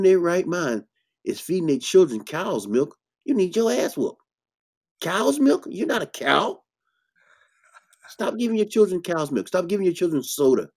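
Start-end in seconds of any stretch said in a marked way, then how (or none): 3.2-9.8 high-pitched, exasperated disbelief; incredulous scolding. Real, not acted. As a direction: scold them with rising incredulity. Scolding parents who feed cow's milk.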